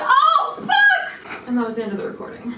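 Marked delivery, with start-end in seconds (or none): 0.0-1.5 very high, loud pained yelp; screaming curse of sudden pain, half laughing; bitten scream. Retold or imitated. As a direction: yelp as the teeth sink in. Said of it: "he's like".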